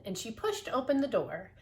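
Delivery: ordinary delivery, nothing marked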